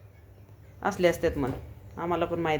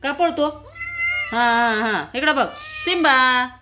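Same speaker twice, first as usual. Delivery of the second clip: raised, higher calling voice; 6 semitones higher than usual. Real, not acted. Owner calling her cats across the house, raised voice.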